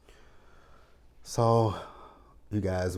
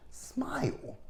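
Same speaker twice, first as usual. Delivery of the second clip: mock-sweet jab, climbing high to the last word; teasing high sing-song smile; sassy retort; 9 semitones higher, much slower than usual. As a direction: pitch leaps way up on smile. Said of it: "I'm like".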